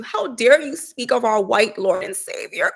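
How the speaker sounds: ordinary talk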